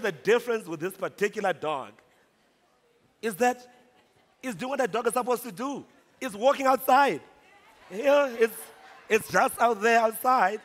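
high, loud exclaiming; emphatic insistence. Retold or imitated. Marketer defending the ad dog to the boss, emphatic insistence. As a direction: hammer it home, pitched high and punchy.

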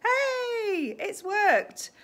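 high, loud cry of triumph; squealed delight. Real, not acted. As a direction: cheer that it worked. Camera flip succeeds.

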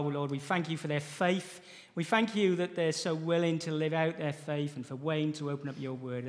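normal talking voice

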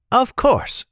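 lightly voiced young guide, high pitch; young guide's voice. A narrator's reading. Guide agreeing to lead the party, high character voice. Boy character replies in story.

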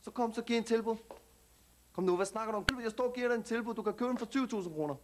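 put-on street slang Danish accent, cocky; perkerdansk accent. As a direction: talk like a tough kid from the suburbs, perkerdansk accent. Same immigrant youth character. (selvsikker perkerdansk accent)

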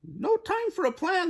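very high, squeaky bird voice; puffing birds. A narrator's reading. Fleeing birds answer the goose, high puffed squeak. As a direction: squeak it out in a hurry. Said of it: "they puffed".

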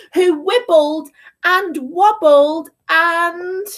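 bouncy rhythmic list of mishaps; children's story narration. A narrator's reading. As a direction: build the mayhem, one animal after another.

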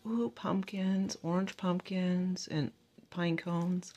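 soft, slow and hushed; hushed over the pumpkins, very soft and slow; delighted hush. Retold or imitated. Shopper recalling spotting pumpkins, soft slow hush. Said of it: "I was like".

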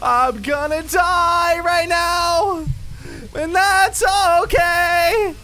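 slow, high, loud panicked shout; terrified yell. Real, not acted. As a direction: wail it out in panic. Screaming in fear.

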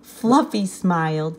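ordinary delivery

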